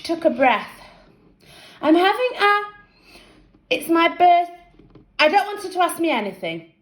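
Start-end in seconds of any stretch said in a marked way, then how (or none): 1.8-4.5 put-on nervous girl voice. A narrator's reading. Colleen nervously starting to ask. 5.2-6.7 snapping. A narrator's reading. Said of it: "Jeff snapped".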